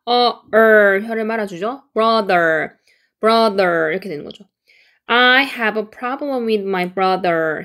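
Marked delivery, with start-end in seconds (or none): 5.1-7.6 very slow, each word clear; slow model sentence; slow careful read. Shown as a demonstration. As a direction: read it slowly for learners. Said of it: "한 번 읽으니까".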